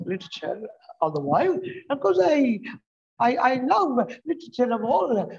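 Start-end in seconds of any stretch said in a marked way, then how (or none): none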